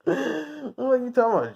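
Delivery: high, incredulous jab; mock disbelief. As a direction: sound amazed anyone would ask. Mocking disbelief at a jury.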